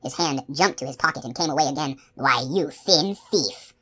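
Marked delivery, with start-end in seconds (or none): 2.1-3.7 slow, lightly voiced menacing brute; grinning iron menace. A narrator's reading. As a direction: draw it out through a hard grin. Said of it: "he said, grinning iron".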